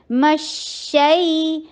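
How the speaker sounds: مشئی slow, شین hissing and flowing; spread sh sound. Shown as a demonstration. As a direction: let the ش flow in مشئی. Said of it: "تفشی کیجیے، رخاوا کیجیے". (مشئی میں شین کی تفشی کے ساتھ)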